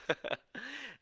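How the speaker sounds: real high, soft chuckle; laugh. Real, not acted. Coach teasing clients to laugh at themselves, chuckling. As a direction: giggle at your own teasing.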